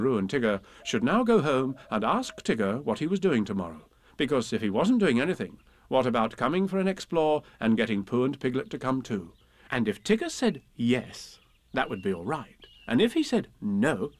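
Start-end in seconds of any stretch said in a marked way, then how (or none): none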